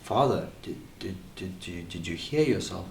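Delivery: very soft, halting, stammered question; hurt, tearful. Acted. Son to father who just shouted, hushed and halting. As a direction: barely get it out, acting a wounded son. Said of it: "I've got tears running".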